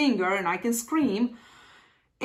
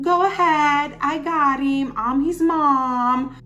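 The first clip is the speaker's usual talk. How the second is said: brisk mother; 5 semitones higher, 4 dB louder, slower than usual. Retold or imitated. Mother waving her off.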